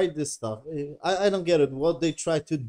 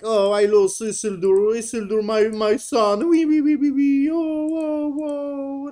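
hammed-up bawling that climbs higher and higher; mock wailing; 10 semitones higher, 5 dB louder than usual. Captain grieving his lost son, mock wailing.

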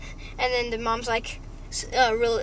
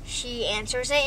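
ordinary delivery

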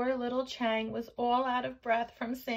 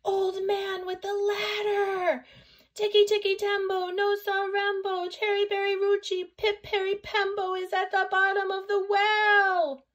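high, loud, sustained yell; last-breath shout; 12 semitones higher, 8 dB louder than usual. A narrator's reading. Chang calls with his last breath, shouting. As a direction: holler it all out on one high note. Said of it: "with his very last bit of breath, he shouted".

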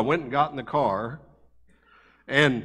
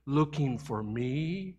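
put-on soft, slow, pleased little whisper of a boy; a child confessing he is the one lost, soft and slow; giggling little boy; 9 dB quieter, much slower than usual. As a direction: let the hidden child giggle it out softly. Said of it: "the little voice giggled".

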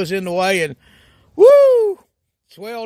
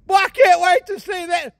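excited shout; 8 semitones higher than usual. Acted. Excited reaction after laugh.

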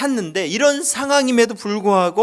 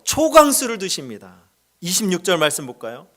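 ordinary talk, nothing marked

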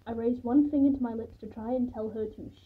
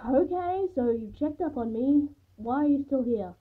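loud, higher-pitched impatient challenge; fed-up, raised voice after a sigh; exasperated; 4 semitones higher, 7 dB louder than usual. A narrator's reading. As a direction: push the unwanted guest to explain. Sighing, impatient question to unwanted guest.

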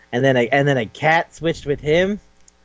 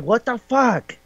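high-pitched mock outrage; shocked; 9 semitones higher, slower than usual. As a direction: pitch it way up, stunned. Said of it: "his girlfriend goes like".

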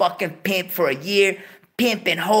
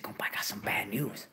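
whispered bad news; hushed whisper; 9 semitones lower, 13 dB quieter than usual. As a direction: breathe it out in a whisper. Friend breaks bad news.

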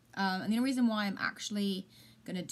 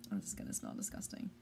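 dreading whisper; 12 semitones lower, 10 dB quieter, faster than usual. Real, not acted. Dreading the sour milk smell.

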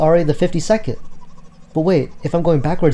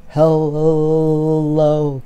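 stretched, louder-held greeting attempt; drawn-out hello; 3 semitones higher, 3 dB louder than usual. Shopper struggling to ask at the store, drawn-out greeting. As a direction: draw "Hello" out on one long held note.

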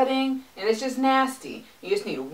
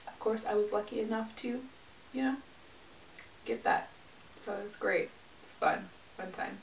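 very soft, dry sarcastic aside; deadpan sarcasm; 14 dB quieter than usual. Real, not acted. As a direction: let the fake enthusiasm fall away quietly. Sarcastic aside on getting back pain.

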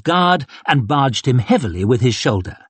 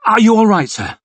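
higher and louder, suddenly worried; alarmed concern; 10 semitones higher, 5 dB louder, faster than usual. Acted. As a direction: rush to check he's hurt. West checks on wounded colonel.

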